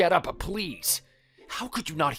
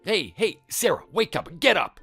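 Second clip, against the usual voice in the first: urgent waking; 4 semitones higher than usual. Acted. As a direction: rouse a sleeper in a panic. Urgently waking sleeping friend.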